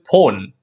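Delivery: "phone" with a hard P, like "pone"; mispronounced phone. Shown as a demonstration. Teacher showing students how not to say it, "phone" with a P sound. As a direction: say "phone" starting on a plosive P. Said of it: "we do not pronounce it".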